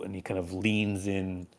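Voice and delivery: ordinary talk, nothing marked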